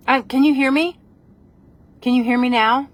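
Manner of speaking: calling out. Real, not acted. Checking the live audience can hear, calling out. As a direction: call out clearly to a listener who may not hear.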